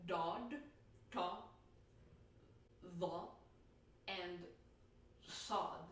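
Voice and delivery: dod, ta, tha and sod said as emphatic sounds; emphatic letter demo. Shown as a demonstration. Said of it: "Okay, we have".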